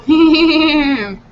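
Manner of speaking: short nervous giggle; nervous laugh. Real, not acted. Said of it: "That's that nervous laugh".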